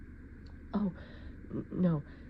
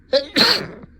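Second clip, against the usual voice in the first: loud, higher insistent denial; 9 semitones higher, 13 dB louder than usual. Acted. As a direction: insist loudly. Insisting not allergic.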